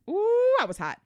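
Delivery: high, loud, heated exclamation; hot with anger. Retold or imitated. Recalling the dropped pharmacy call, she flares up angrily. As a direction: let the anger flare up again. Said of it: "I was so mad".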